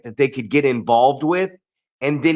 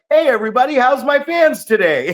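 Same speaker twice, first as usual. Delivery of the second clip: higher, louder, showman's cheery hello; cheery crowd greeting; 10 semitones higher, 5 dB louder than usual. Retold or imitated. Wrestler turning from beating heel to greet fans cheerily. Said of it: "he looks up at the crowd like".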